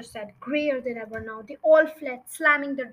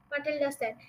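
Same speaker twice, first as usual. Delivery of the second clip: usual speaking voice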